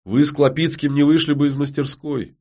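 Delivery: mildly scornful, forceful rebuke; angry. A narrator's reading. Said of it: "зло бросила Ярина".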